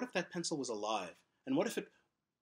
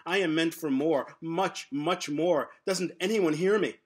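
higher and louder anguished protest; acted scream; 4 semitones higher, 7 dB louder, slower than usual. Pencil protesting its purpose while being used, loud and high. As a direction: cry out against his fate. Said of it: "he screams".

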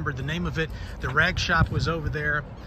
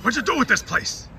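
higher-pitched Pacino-style demand; Al Pacino impression; 7 semitones higher than usual. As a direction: ask it like a Pacino character. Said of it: "If I were Pacino".

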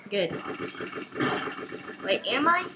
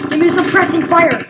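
loud, higher call for cover; cover me shout; 4 semitones higher, 8 dB louder than usual. Pretend. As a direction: bark for suppressing fire. Calling for cover fire.

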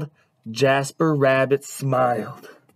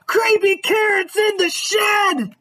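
high, panicked scream; frightened kid raising the alarm. A narrator's reading. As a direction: shriek a terrified warning to mom. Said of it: "Jasper screamed".

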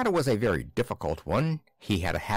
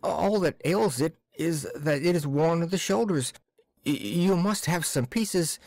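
higher, anxious pleading clerk; pleading timid clerk; 5 semitones higher than usual. Acted. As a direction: plead for a simple fix. Akaky keeps pleading.

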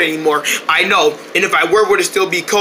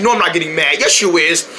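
ordinary talk, nothing marked

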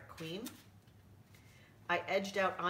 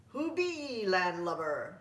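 pirate queen; 5 dB louder than usual. Acted. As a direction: growl it like a pirate captain. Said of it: "said the woman".

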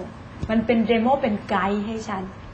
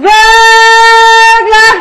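รัก belted much higher and louder, pushed hard; imitating a shouted high note; 12 semitones higher, 16 dB louder than usual. Shown as a demonstration. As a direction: show the strained, pushed-out รัก he sang. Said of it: "แต่ตะกี้ร้อง". (ตะโกนคำว่ารักเสียงสูงดังแบบเบ่ง)

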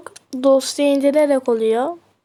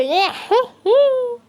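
high laugh; 11 semitones higher than usual. Real, not acted. Laughs at own music.

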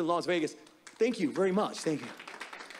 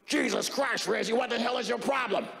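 Nick Nolte impression; 3 semitones higher than usual. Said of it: "you remember Nick Nolte go".

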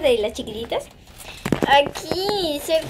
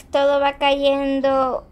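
slow showing; much slower than usual. Shown as a demonstration. Showing slime.

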